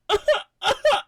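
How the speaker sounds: high, loud sobbing wail; high wailing sob; crying sob. Retold or imitated. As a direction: break into a sob. Tearful reaction in theater.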